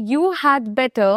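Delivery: "You had better" said slowly word by word; broken apart. Shown as a demonstration. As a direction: split "You had better" into separate words. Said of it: "এটাকে আমি ভেঙে বললাম". ("You had better" ভেঙে ধীরে ধীরে)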